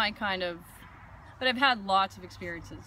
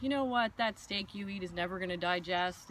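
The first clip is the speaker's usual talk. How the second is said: ordinary talk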